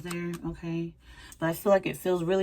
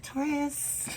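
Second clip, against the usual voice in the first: flirty call; 11 semitones higher, 5 dB quieter than usual. Pretend. Acts an admirer calling Taurus.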